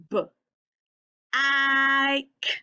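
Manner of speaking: "B. I. K." broken apart, I held level; sounding it out. Shown as a demonstration. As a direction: say each sound of bike alone, drawing out the I. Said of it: "stretching the word out, breaking it down".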